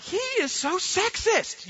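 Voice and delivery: high, slow, exaggerated outrage; drawn-out mock disgust; mock indignation. Dad reacting to his son's demand, mock outraged. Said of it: "I'm like".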